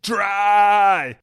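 high, loud shout of "Dry"; the punchline shouted across the table; shouted pun. Pretend. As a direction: yell "Dry" as the punchline with "r" in "Dry" stretched like German "drei".

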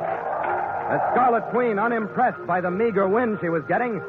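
ordinary delivery

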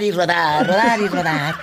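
chuckling through the repeated words; laughing riff. Real, not acted. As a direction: laugh while you repeat it. Host riffs laughing.

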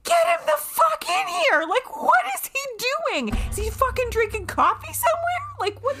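high, frantic mock yell; high-pitched yell at the absent doctor; retold yelling. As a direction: shriek it like mid-labor panic. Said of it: "that was when the yelling began".

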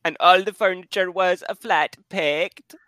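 put-on a touch of a Swedish accent. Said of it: "I was going for Swedish".

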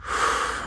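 imitated calming breath, blown out; long pursed-lip puff of air; forced exhale. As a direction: blow out a breath to steady nerves. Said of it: "then I start kind of like".

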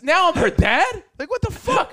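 high-pitched comic outrage; incredulous, worked-up protest; indignant disbelief. Retold or imitated. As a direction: climb high in offended disbelief. Indignant at being taken for dad.

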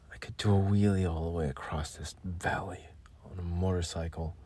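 dreamy soft whisper. Pretend. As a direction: whisper the fantasy. Whispered ad line.

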